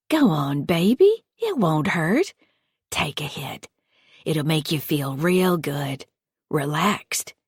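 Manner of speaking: lightly voiced sugary stepmother, coaxing; a creepy mother figure cooing at a hurt kid; Patty's coaxing voice. Creepy foster mother coaxing the hurt child, sweetly.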